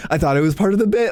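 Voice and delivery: squeaky voice for friend; high mock Jay. Mocking his teammate saying he banks there, high squeaky voice. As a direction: pitch the friend way up.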